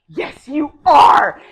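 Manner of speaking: slow, high, loud shout; shouting demand. Acted. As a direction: yell it at him, drawn out. Wife grabs Joseph, shouts.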